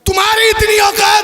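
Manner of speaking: तुम्हारी इतनी औकात thrown out high and loud; preacher repeats the king's angry line for the audience; emphatic shout. Shown as a demonstration. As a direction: repeat the line loudly so it lands. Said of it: "सुरूर भाई, जुमला सुनिएगा".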